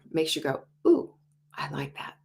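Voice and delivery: normal talking voice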